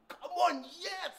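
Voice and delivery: high-pitched urging; excited cheering. Real, not acted. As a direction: cheer them on, pitched right up. Cheering the team.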